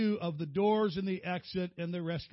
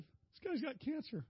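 high, soft imitation of a weepy husband; mock tearful; 8 semitones higher, 6 dB quieter than usual. Crying husband explains his book to his wife, mock tearful. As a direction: whimper it, playing up the upset. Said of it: "I started crying".

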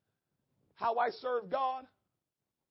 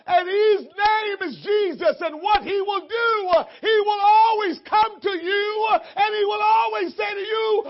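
high, shouted pulpit crescendo; fervent, loud, high-pitched preacher's cadence; full-force preaching; 12 semitones higher, 14 dB louder than usual. Real, not acted. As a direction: punch each phrase out with a gasped breath between.

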